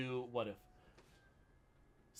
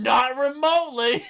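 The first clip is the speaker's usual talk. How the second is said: high and laughing; laughing quote; 8 semitones higher, 14 dB louder than usual. Retold or imitated. Podcaster re-quoting the review, laughing. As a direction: giggle through the words. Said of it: "it's like he's monologuing".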